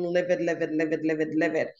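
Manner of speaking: livid repeated low in an even run; low, insistent repeats of livid; repeated LIVID for emphasis. Real, not acted. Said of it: "When I say livid, I mean".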